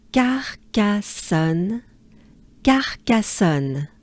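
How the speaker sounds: slow, joined "Carcassonne" in French; linked up slowly. Shown as a demonstration. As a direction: glide the syllables together slowly. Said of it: "try to link them together".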